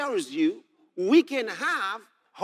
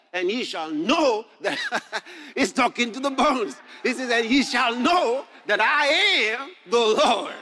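preaching broken up by laughter; delighted laughing; 3 semitones higher, 4 dB louder than usual. Real, not acted. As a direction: laugh with wonder, then proclaim it loudly. Preacher laughs in delight while reading.

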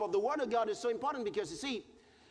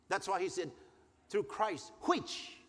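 usual speaking voice

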